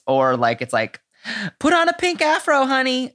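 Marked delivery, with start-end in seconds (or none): 1.6-3.1 high-pitched put-on sassy voice; campy mock queen. Podcaster mocking silly challenge takes, campy high voice. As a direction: squeal it like a silly drag queen. Mocks campy alternative to serious political message.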